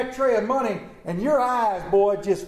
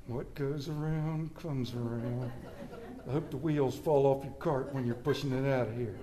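muttered grudge; 8 semitones lower, 9 dB quieter than usual. Retold or imitated. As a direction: mutter the curse under your breath. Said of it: "We use that statement when we're mad".

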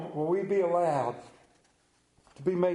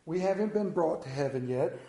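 ordinary talk

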